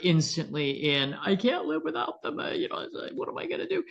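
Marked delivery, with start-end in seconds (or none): 1.1-3.8 despairing owner. Pretend. Owner's anticipatory grief.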